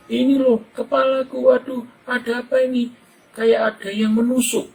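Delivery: put-on stung boy showing his head to his mother; crying child. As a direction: plead with mom like a hurt little boy.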